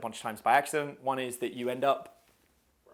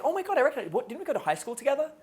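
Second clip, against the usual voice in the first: acted guy greeting; 10 semitones higher, faster than usual. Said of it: "I'm like".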